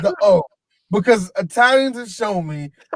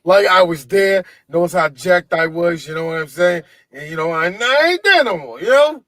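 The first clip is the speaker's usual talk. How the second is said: Gotti Junior imitation; 6 semitones lower than usual. Imitating John Gotti Junior talking.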